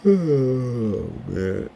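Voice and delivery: words stretched through a big yawn, pitch jumping high. Real, not acted. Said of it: "Sorry, I was yawning".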